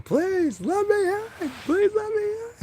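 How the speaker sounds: very high, whiny plea; desperate begging. Acted. Vessel clinging to Bug's legs, begging. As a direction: beg like a clingy kid at the door.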